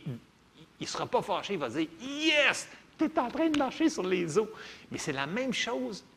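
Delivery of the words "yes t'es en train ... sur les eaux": high, bright mock cheer of God. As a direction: cheer them on like a proud coach. God voiced cheering the believer. (Dieu joué, enjoué et aigu)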